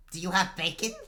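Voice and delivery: put-on high, loud child's question; eager little boy. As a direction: ask like a hopeful five-year-old. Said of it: "this little boy's eyes just light".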